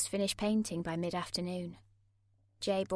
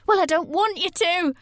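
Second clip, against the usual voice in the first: much higher, louder scream; 10 semitones higher, 9 dB louder than usual. A narrator's reading. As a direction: scream it back at him. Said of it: "I screamed".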